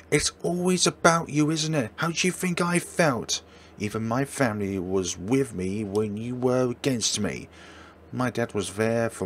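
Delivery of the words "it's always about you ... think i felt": higher, heated and accusing; angry accusation. Acted. Boyfriend hurling accusations at his girlfriend, angry. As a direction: throw it back at her.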